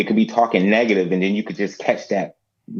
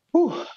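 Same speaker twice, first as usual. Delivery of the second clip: relieved whew. Real, not acted. Emotional relief at turning forty-one.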